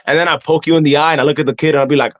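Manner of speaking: usual speaking voice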